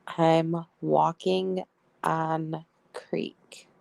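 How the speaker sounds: very slow word-by-word readout; slow, deliberate quote. Retold or imitated. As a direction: read each word out slowly, spaced apart. Reading out odd text message.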